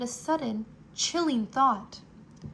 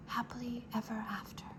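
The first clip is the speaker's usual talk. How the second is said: soft fast whisper, no voice; 10 dB quieter, faster than usual. Acted. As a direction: breathe it to yourself. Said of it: "whispered Despereaux".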